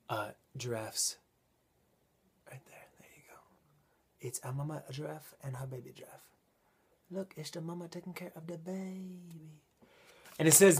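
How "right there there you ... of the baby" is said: hushed, secretive whisper. Real, not acted. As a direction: keep it down so she won't hear. Friend is behind the wall.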